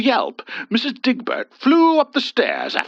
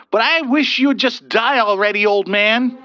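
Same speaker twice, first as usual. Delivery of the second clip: louder, higher spiteful jab; irate; 10 semitones higher, 5 dB louder than usual. A narrator's reading. Angry youth cursing the old husband, louder. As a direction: spit it at the old man. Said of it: "The boy grew irate".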